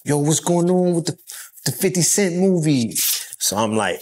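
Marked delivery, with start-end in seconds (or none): 0.0-2.9 light New York accent, pitched higher, on a retold question; quick put-on New York accent, half joking. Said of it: "I had to use my New York accent".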